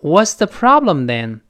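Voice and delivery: "What's the problem then?" loud and slow, falling at the end; falling question tune. Shown as a demonstration. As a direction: ask "What's the problem then?" with the pitch dropping on "then". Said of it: "最后是降调".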